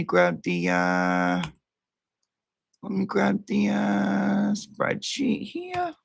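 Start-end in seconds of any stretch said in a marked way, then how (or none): 2.8-5.9 sing-song. Acted.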